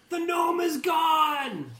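drawn-out high shout; high, loud, slow yell across a battlefield; yelling back. Acted. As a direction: shout the alarm back to the group. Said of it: "and she yells back".

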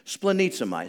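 ordinary talk

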